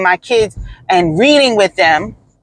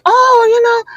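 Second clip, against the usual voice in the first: flustered coworkers; 14 semitones higher than usual. Retold or imitated. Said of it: "they were like".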